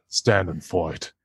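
measured samurai challenge, a bit slow; a hint of a stern swordsman; the character voice. Shown as a demonstration. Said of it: "I don't have to do The Voice".

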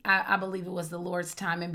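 ordinary delivery

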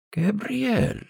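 put-on high gravelly old woman's correction; high-pitched, disapproving old crone. A narrator's reading. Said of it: "The old woman's gravelly voice".

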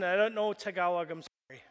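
normal talking voice

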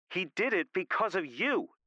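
high, hard-hitting blame; high-pitched, venomous accusing; hateful accusation. A narrator's reading. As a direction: spit the blame out with hatred. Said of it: "her hatred coming through loud and clear".

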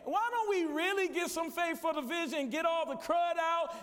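loud, driving sermon climax; rally the church to action; preaching at full force. Acted. Preacher rallying the congregation to win the city, full force.

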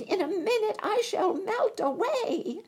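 very high, loud panicked witch; witch screaming. A narrator's reading. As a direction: shriek it out. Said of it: "she screamed".

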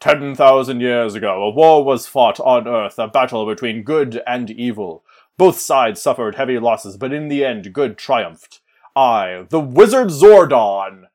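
low, slow booming wizard narration; Zordon voiceover. Acted. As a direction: intone like an ancient floating head. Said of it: "He's gonna be like".

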